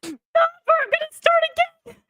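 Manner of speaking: high, teary laughing; laughing through the plea. Real, not acted. Still laughing.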